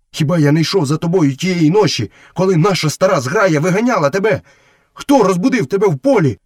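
high, indignant wolf voice; loyal wolf protesting. Acted. Wolf reminding Mowgli he followed him, high and protesting.

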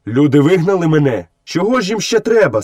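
indignant questions, climbing high at the end; angry protest. A narrator's reading. Mowgli protests that people drove him out, angrily. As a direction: build to a high-pitched, cross demand. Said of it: "сказав Мауглі сердито".

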